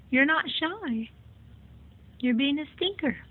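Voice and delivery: high teasing scold; high tease. Real, not acted. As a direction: chirp You're not shy up high. Teasing the pet again.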